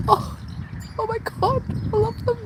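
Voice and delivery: high, thrilled squeal; delighted squeal. Real, not acted. Watching the house martins fly, squealing with delight. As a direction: gush over the birds in a high voice.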